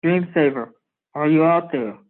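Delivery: muffled like over a radio; distant muffled radio call. Acted. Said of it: "a very muffled voice says".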